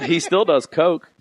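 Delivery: giggling through the words; laughing as he tells it. Real, not acted. Friend laughing mid-story about a crazy guy.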